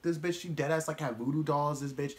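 ordinary talk, nothing marked

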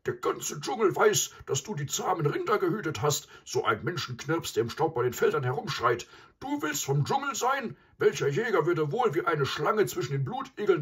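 loud, high, furious buffalo tirade; outraged, high-pitched ranting; foaming with rage. A narrator's reading. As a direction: rage at the intruder as an enraged bull. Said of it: "Mysa schäumte vor Wut".

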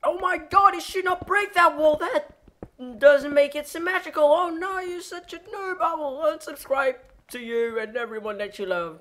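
very high, loud squealed rant; mock screaming viewer. Annoyed viewer screaming at the screen, hammed-up shriek. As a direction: squeal in exaggerated outrage at the screen. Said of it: "scream at the screen saying".

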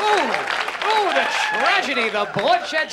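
mock passion over the bloodshed; mock arousal. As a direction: moan the words like it's turning you on. Mother mock-aroused by tragedy.

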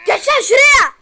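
loud, high angry shout. Real, not acted. Gamer shouting at his stuck character, loud angry shout. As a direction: bark it at the screen in rage.

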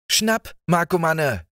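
high teasing call; calling out to the dog. A narrator's reading. Boy teases the dog with a treat, high call. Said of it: "rief er".

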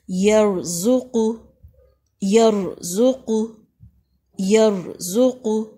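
"यरज़ुक़ु" slowly, with "र" and "क़ु" full and heavy; drawn-out recitation of "यरज़ुक़ु"; slow heavy R and Qaf. Shown as a demonstration. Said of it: "इसको पुर पढ़ेंगे".

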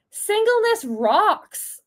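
excited cheer. Real, not acted. Cheering on single crew.

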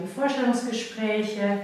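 ordinary talk

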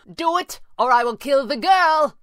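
high, loud angry threat; enraged villain's yell; furious cry. A narrator's reading. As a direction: shout the threat in fury. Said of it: "Pavli cried, furious".

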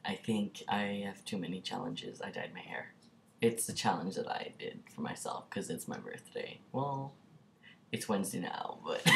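soft breathy whisper. Real, not acted. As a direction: whisper it like a secret. Winding down the video.

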